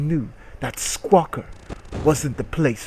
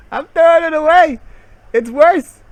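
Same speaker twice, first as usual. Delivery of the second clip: much higher, louder, cracking up; laughing outburst; 10 dB louder than usual. Real, not acted. Streamer disgusted by the product, loud laughing outburst. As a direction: yell it out through the laughter.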